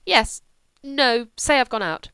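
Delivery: sudden brisk reversal; quick change of mind. Acted. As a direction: catch yourself and reverse. Julia changes her mind.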